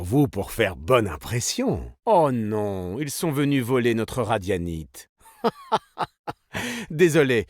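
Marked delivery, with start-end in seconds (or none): 5.4-6.1 high, quick chuckle; a brief laugh before getting down to business; short laugh. Acted. Laughing before a mock apology, short high chuckle.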